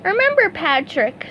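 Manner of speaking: very high, eager cartoon sponge; bright squeaky helper ready to go; eager SpongeBob voice. A narrator's reading. SpongeBob volunteering to his boss, high eager voice.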